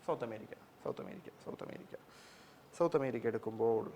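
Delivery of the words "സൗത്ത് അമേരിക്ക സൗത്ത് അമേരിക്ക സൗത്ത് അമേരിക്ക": whispered repetition, trailing softer; softer whispered muttering while searching the map; whispered to herself. Real, not acted. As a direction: whisper it over to yourself as a reminder. Teacher repeats name to herself.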